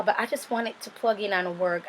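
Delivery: usual speaking voice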